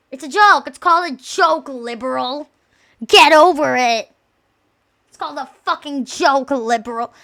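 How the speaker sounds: loud, emphatic mock scolding; mock indignant shout. Streamer telling objectors it's a joke, mock indignant shout.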